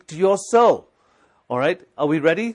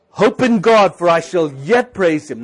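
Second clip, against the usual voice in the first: higher, louder declaration; speaking to your soul; 3 semitones higher, 10 dB louder than usual. Shown as a demonstration. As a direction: command your soul to hope. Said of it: "Talk to your soul that's in there and say".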